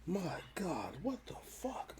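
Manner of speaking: hushed, voiceless disbelief; barely audible, stunned whisper; appalled whisper. Real, not acted. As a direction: whisper it, aghast at how bad it is. Appalled by the team's roster.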